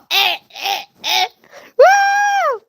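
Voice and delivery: high little chirps into a piercing woo; celebratory whoop. Real, not acted. Streamer celebrating five hundred subscribers, high whoops. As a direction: yelp in bursts, then let out a high whoop.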